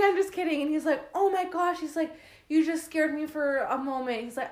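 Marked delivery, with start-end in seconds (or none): 0.0-0.6 high, loud just kidding; excited. Retold or imitated. Revealing the prank. 1.1-4.2 high, loud relieved gush. Retold or imitated. Boyfriend's relief imitated.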